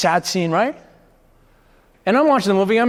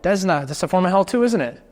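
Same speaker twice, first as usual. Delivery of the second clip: ordinary talk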